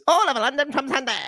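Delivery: high-pitched mock seventies announcer, laughing; old broadcast voice. Imitating the old TV trailer for a friend, a high laughing announcer. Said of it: "That old broadcast voice is hilarious".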